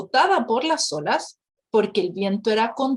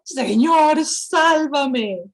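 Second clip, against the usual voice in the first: louder desperate call to the Lord; cry for help; 3 semitones higher, 4 dB louder, much slower than usual. A narrator's reading. Peter sinking in the water, crying out to Jesus. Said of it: "Entonces gritó".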